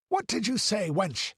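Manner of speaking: glare and demand wildly. A narrator's reading. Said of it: "glaring wildly at the child".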